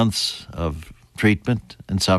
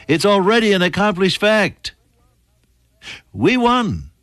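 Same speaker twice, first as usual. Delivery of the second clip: higher, ringing declaration of victory; triumphant proclamation; 8 semitones higher than usual. Real, not acted. Preacher assuring congregation the battle is won, triumphant. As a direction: proclaim it with rising conviction.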